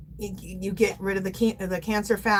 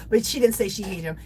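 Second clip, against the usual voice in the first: normal talking voice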